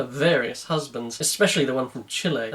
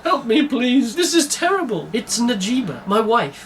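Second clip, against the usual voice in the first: high, loud, desperate plea; acted weeping; 8 semitones higher, 3 dB louder than usual. A narrator's reading. His Excellency begging Cindy over his wife, acted weeping. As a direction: wail it high, nearly out of control. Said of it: "He was weeping, nearly out of control".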